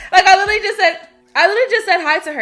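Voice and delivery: loud, high, laughing; amused incredulity, quick; laughing disbelief. Real, not acted. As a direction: spill it out through laughter.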